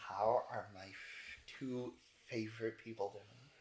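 slow, soft, sultry greeting; Riza greeting. Acted. Vampire lady Riza greeting her favourites, slow and soft. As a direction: draw it out softly, savouring each word. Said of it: "she goes".

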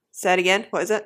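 ordinary talk, nothing marked

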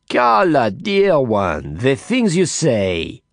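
mock scolding from a flamboyant friend; Didier, teasing. Didier's voice begins.

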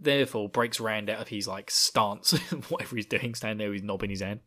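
ordinary talk, nothing marked

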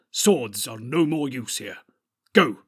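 loud, slow, emphatic order; fierce command. A narrator's reading. Wizard sends companion away from the door, fierce. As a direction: press each word, then fire off the last. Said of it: "said Gandalf fiercely".

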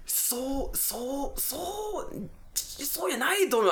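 mock the flustered agent, slowing on the last bit; high-pitched apologetic clerk voice; flustered phone agent.

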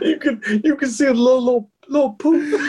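high, words pushed through laughter; giggling high punchline; laughing while talking. Real, not acted. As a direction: crack up as you say it.

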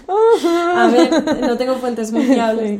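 words bursting out in real laughter; laughing. Real, not acted. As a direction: talk through a loud, high laugh. Se ríe de su propia explicación. (hablando entre carcajadas)

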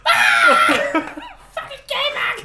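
high, loud celebratory scream; retold ecstatic yell, laughing at a car show. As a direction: scream with joy for your driver. Speaker celebrates qualifying at car show.

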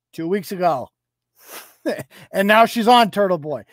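ordinary talk

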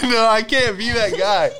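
talking through real laughter; loud, laughing aside. Real, not acted. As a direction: still cracking up while explaining. Still laughing after the bit.

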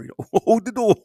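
laughing through a hammed-up imitation; high, loud mocking voice breaking into laughs. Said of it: "he taught me".

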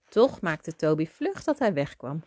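ordinary delivery, nothing marked

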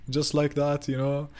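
laughing through the words; a real laugh while telling it. Real, not acted. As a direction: chuckle it out. Laughing while retelling gun scene.

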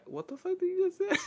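imitating a friend in a high squeal; high-pitched mock disbelief; incredulous friend. Quoting the best friend's shocked reaction.

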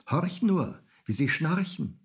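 high cat voice; cat calling down. A narrator's reading. Cat points Häwelmann to sleeping animals, calling down. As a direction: urge the listener to hark.

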